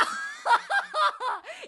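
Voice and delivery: very high, loud cackling burst; real shrieking laugh; explosive laughter. Real, not acted. Cracks up at game's name.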